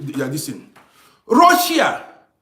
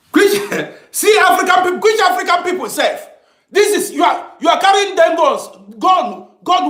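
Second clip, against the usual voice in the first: heated shouting; 9 semitones higher than usual. Real, not acted. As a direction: yell it at the viewers in disbelief. Ranting at viewers about Africa.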